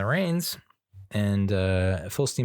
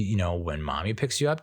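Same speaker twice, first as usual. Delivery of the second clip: ordinary talk, nothing marked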